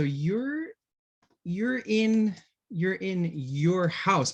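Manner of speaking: "you're in your house" drawn out, every word slow; slow teacher model; slow demo sentence. Shown as a demonstration. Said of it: "aquí no hay cambio de pronunciación".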